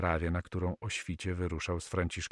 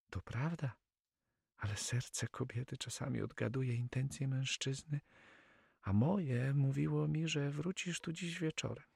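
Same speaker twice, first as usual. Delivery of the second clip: high, soft female character voice; blushing Flemish girl; 7 semitones higher, 6 dB quieter than usual. Acted. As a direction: confide it shyly, higher and softer. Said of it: "rumieniąc się". (nieśmiałe, ciche wyznanie dziewczyny)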